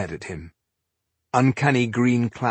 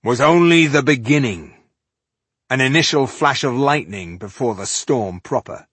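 raised, ominous pronouncement; dark warning; 4 semitones higher, 6 dB louder than usual. A narrator's reading. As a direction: warn grimly of worse to come. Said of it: "Tungdil told Balindar darkly".

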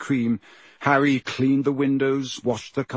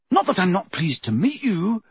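hasty, higher-pitched apology; quick reassurance; 7 semitones higher than usual. A narrator's reading. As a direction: hurry to smooth it over. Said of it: "said Harry quickly".

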